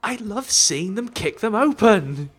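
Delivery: high, drawn-out gush; gushing delight. Real, not acted. Delighting in how boxes get kicked open, gushing.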